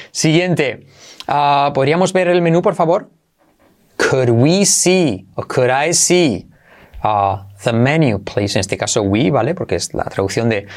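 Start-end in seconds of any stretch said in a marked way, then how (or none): 4.0-8.6 slow, careful word-by-word demonstration; deliberate, slowed teaching delivery; slow model phrase. Shown as a demonstration. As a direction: draw out each word for learners. Teacher models the sentence slowly.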